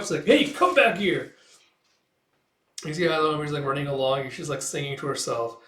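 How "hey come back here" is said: raised, slow, high-pitched call; calling after someone. Retold or imitated. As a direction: holler after someone getting away.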